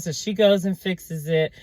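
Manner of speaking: normal talking voice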